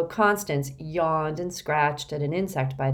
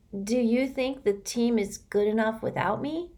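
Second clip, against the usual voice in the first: higher, muffled and timid; 6 semitones higher than usual. Acted. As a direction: mumble it from behind your knees. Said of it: "came the muffled voice".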